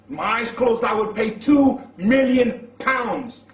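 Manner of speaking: loud, high, slow declaration; emphatic acted vow. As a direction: declare it grandly, stretching the words. Acted father's emphatic vow in story.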